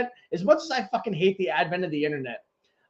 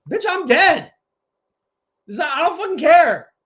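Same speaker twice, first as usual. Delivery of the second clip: high, loud snap back; angry retort; 8 semitones higher, 6 dB louder than usual. Retold or imitated. Answering the therapist's family question, angry and loud. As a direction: throw it back at the doctor, fed up. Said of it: "and then when they're like".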